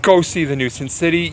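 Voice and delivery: ordinary talk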